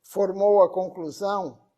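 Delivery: slow, deliberate reading with long pauses between phrases; formal devotional tribute reading; solemn reading aloud. Acted. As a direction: read it out measured, phrase by phrase. Reads a tribute text aloud.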